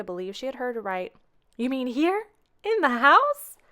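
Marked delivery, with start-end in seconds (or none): 1.6-3.6 rising higher and louder in alarm; horrified at the thought, voice climbing; aghast. Acted. Grandmother to Maggie about Mister Whiskers coming, aghast.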